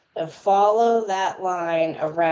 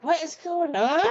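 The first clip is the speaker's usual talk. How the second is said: high-pitched baffled audience voice; mock bewilderment; 9 semitones higher than usual. Host imitating everybody in the chat, mock bewildered. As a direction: squeal it in playful confusion.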